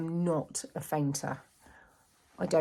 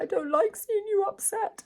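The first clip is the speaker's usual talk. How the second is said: imitating a small worried son, much higher; little boy voice; 3 dB louder than usual. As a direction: pitch it up like a little boy fretting over mum. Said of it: "He was like".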